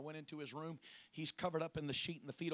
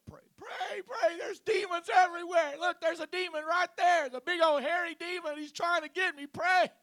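high, loud panicked screaming; terrified shout; 17 dB louder than usual. Retold or imitated. Hallucinating old man screaming for prayer, terrified. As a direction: shriek it out like a man seeing demons. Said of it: "he's scared to death".

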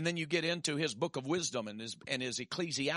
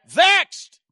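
much higher, loud cry of "Vexed"; shouted refrain; 16 dB louder than usual. Acted. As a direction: throw "Vexed" out hard.

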